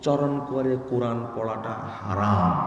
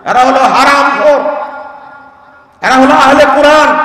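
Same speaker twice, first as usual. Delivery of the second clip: fiery preaching; 12 semitones higher, 15 dB louder than usual. Acted. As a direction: thunder it from the pulpit. Preaching at full force.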